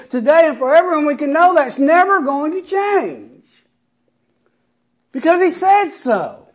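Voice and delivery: loud, high, rising preacher's cry; preaching at full force. Acted. Pastor rousing the congregation with the promise, preaching loud. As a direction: build the scripture up like a sermon climax.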